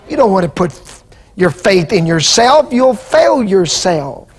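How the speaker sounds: normal talking voice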